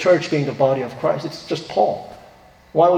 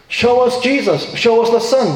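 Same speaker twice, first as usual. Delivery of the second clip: raised, insistent pleading demand; mock demand; 7 semitones higher, 3 dB louder than usual. Pastor mimicking skeptics demanding proof, raised insistent. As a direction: throw the challenge up high and pushy.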